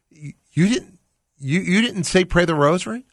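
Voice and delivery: high, loud stammering disbelief; stunned disbelief. Retold or imitated. Husband asking his wife, stunned, in high loud disbelief. Father stunned, quoted in story.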